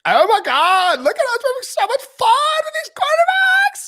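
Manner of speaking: put-on squealing high, loud Valley Girl gush; mocking the Seahawks with a shrieking Valley Girl; Valley Girl impression. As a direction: gush like a giddy teen at the mall. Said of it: "a Valley Girl impersonation".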